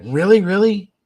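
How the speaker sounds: imitating a high, loud incredulous reply; mock astonishment, pitch shooting up. Friend retells Dan asking about the tape, incredulous. Caller reacts with disbelief.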